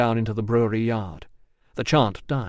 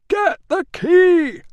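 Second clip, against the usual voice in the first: very high, loud, slow shout; urgent shout; 7 dB louder, much slower than usual. A narrator's reading. Catavignus shouting to Rousseau from inside. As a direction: yell the order urgently. Trapped man calling out.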